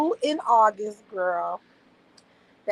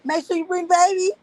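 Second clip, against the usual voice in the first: high, louder eager demand; a doting friend's mother insisting, high and eager; eager older lady; 8 semitones higher, 6 dB louder than usual. Retold or imitated. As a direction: chirp it like a doting grandma. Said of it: "she wanted my baby".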